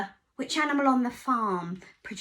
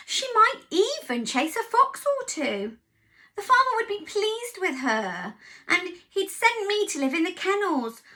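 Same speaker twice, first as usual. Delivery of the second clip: dog voice; 7 semitones higher, 4 dB louder than usual. Acted. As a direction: voice the anxious sheepdog. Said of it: "said Dog".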